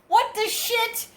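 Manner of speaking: very high, excited mock squeal; astonished fans. Fans reading his name on the card, high astonished imitation. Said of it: "they're both like".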